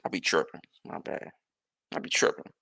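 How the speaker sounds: soft, low, mumbled apology; sheepish other driver. Pretend. The swerving driver answering him, soft sheepish mumble.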